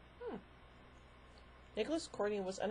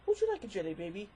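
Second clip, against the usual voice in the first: Doctor voice; 3 dB louder, faster than usual. Pretend. String of Doctor catchphrases.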